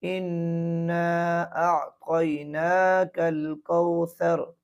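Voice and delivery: slow इन्ना with held nun, आतैनाकल stretched; slow tajweed recitation; correct recitation. Shown as a demonstration. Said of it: "तो हम इसको यूँ पढ़ेंगे".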